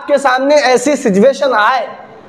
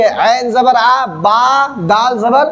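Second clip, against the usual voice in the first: slow, syllable by syllable spelling; much slower than usual. Shown as a demonstration. Teacher spelling letters slowly to students. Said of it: "हिज्जे करेंगे तो कैसे करेंगे".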